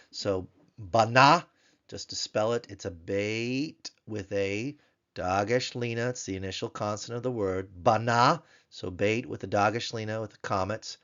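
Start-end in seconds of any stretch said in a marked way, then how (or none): 0.1-1.4 slow "bana," rising, louder; Hebrew bana. Shown as a demonstration. Teacher reads the Hebrew word aloud to class. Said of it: "we'll spell it and read it". 7.8-8.4 slow "bana," rising, louder; Hebrew bana. Shown as a demonstration. Teacher reads the Hebrew word aloud to class. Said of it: "we'll spell it and read it".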